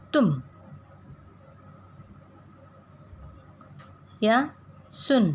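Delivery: छोटा ऊ धीमे स्वर में: "तुम", "सुन" हल्के छोटे स्वर में; "तुम" और "सुन" को कम, हल्के उ के साथ बोलें; short u demo. Shown as a demonstration. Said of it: "धीमे स्वर में होता है". (छोटे उ का धीमा उच्चारण)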